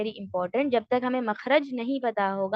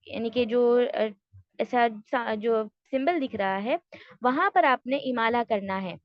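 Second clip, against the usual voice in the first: usual speaking voice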